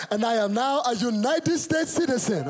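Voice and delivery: put-on high, proud Nigerian-accented announcement. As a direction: declare it as an imitated Nigerian father. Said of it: "No citizenship was changing that accent".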